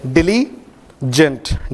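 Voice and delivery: diligent drawn out very slowly; teacher modelling diligent slowly; slow careful model. Shown as a demonstration. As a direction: stretch diligent out syllable by syllable, di-li-gent. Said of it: "diligent है पहला शब्द".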